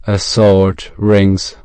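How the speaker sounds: ordinary delivery, nothing marked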